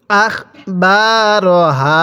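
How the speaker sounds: very slow, drawn-out syllables; model recitation. Shown as a demonstration. Teacher models Quran words for learners, slow measured recitation. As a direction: stretch each long vowel evenly. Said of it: "এরকমভাবে শিখব".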